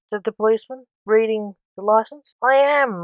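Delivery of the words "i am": higher, mildly whiny insistence; moaned protest. A narrator's reading. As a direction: protest with a touch of a moan. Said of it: "moaned Blake".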